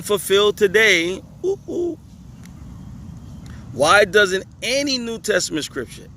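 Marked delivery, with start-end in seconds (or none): 1.4-2.0 high falsetto "ooh, ooh"; two little high-pitched hoots of mock alarm; teasing ooh. Teasing reaction to the question. 4.6-5.0 "any" pushed up high and stretched; stress on any. Real, not acted. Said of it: "he capitalized any here".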